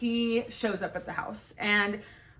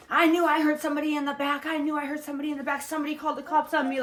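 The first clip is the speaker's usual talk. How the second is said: higher, hurried mock paranoid ex-boyfriend; 7 semitones higher, faster than usual. Ex-boyfriend panicking as cops arrest him, mock paranoid. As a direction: lightly voice him frantic and sure he was ratted out. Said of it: "just getting all paranoid".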